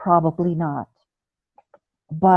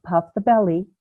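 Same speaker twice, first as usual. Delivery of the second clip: ordinary talk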